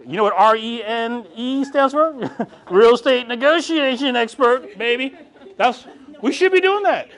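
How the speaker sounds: high, cocky playful brag; mock proud boast. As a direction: show off the title with a grin. Bragging about realtor title.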